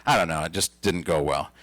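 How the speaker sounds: dejected. Retold or imitated. Comic telling mom the show bombed, dejected and low. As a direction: admit the show flopped, glumly. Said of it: "I said".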